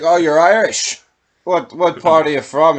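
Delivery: loud Irish gangster. Retold or imitated. Voicing Devlin questioning Murdoch.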